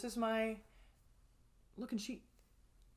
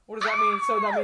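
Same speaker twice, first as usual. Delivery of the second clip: shouted, high, playful confusion; loud mock confusion; 11 dB louder than usual. Real, not acted. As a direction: yell it out like you're baffled. Mock-baffled loud question.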